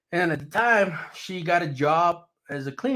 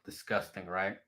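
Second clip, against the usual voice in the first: disgusted; 7 semitones lower, 12 dB quieter than usual. Retold or imitated. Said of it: "I'm like".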